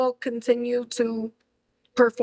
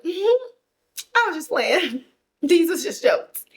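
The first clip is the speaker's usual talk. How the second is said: nervous backpedal; 6 semitones higher than usual. Retold or imitated. Inuo backpedaling, laughing nervously.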